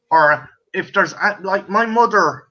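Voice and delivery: usual speaking voice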